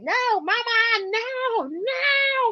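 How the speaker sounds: put-on high, wailing little-kid protest; imitating crying toddler. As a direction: cry it out like a toddler begging mama. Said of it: "You hear him?".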